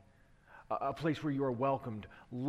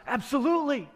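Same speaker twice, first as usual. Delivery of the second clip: eager absolutely; 10 semitones higher, 6 dB louder than usual. Acted. Woman eager for living water.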